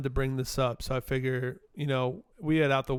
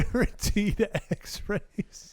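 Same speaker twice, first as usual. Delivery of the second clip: laughing; 5 semitones higher, 4 dB quieter, much slower than usual. Real, not acted. Echoing friend, laughing.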